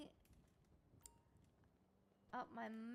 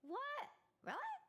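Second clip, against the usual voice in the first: surprised; 12 semitones higher than usual. Real, not acted. Shocked at chatbot answer.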